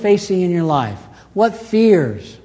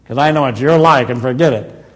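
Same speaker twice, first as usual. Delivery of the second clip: mock dismissive aside; 4 semitones lower, 3 dB louder than usual. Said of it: "He doesn't say".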